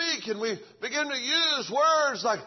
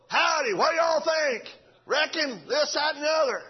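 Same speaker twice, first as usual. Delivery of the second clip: put-on country folk accent; 5 semitones higher than usual. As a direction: holler a folksy Southern howdy. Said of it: "He was country folk".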